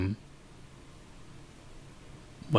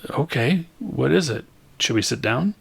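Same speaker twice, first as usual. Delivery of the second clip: higher, uneasy questions; nervous; 6 semitones higher than usual. Acted. Karen hears Elliot must confess, higher and uneasy. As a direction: ask warily, bracing yourself.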